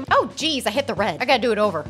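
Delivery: ordinary talk, nothing marked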